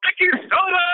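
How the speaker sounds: name called out high and loud; cheering a colleague's name; excited shout-out. Real, not acted. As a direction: sing out the name like a hype man. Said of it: "some people's names you just gotta repeat".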